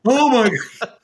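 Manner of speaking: high, loud frightened exclamation; mock terrified outburst; scared gasp. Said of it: "But I was so scared".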